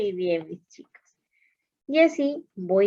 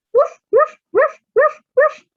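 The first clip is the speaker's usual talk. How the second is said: dog barking; 9 dB louder than usual. Shown as a demonstration. Teacher voicing dog sound.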